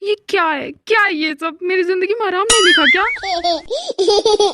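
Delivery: exasperated complaint. Acted. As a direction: wail in mock despair about your lot. पिता आराम न मिलने पर झुंझलाया. (झुंझलाकर शिकायत)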